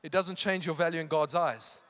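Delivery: loud, high, drawn-out preaching; full-force preaching. Real, not acted. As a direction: preach it with urgent conviction.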